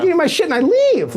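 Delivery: normal talking voice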